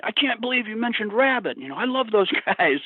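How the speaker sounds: high excited friend voice; quoting a friend. Retold or imitated. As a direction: mimic the friend's delight. Friend quoted praising the book.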